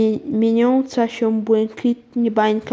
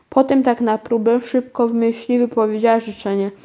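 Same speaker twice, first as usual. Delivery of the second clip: ordinary delivery, nothing marked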